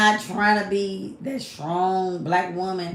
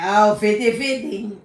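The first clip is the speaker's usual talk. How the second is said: louder, drawn-out mock quote; parroting a tired line; mimicking other men; 2 semitones higher, 6 dB louder, slower than usual. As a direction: say it slow and loud, imitating them. Mimics men insisting on fifty-fifty.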